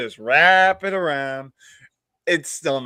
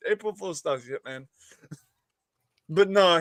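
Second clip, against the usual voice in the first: normal talking voice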